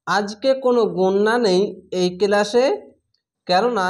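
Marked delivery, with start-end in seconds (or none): none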